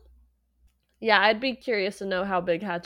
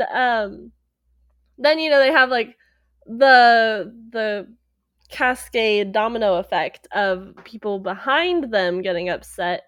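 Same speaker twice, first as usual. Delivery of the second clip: ordinary talk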